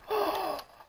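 sudden high gasp; sharp gasp. Real, not acted. Gamer struck in the game, sharp gasp. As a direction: catch your breath in surprise.

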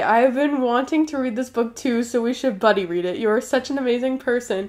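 usual speaking voice

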